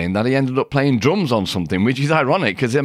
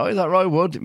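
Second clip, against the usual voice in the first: lightly voiced, higher-pitched phone enquiry; imitated caller; 7 semitones higher than usual. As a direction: ask it as a hopeful caller, pitched up. Acted phone call from [name].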